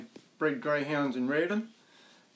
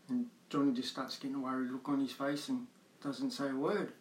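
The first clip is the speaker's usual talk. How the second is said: ordinary talk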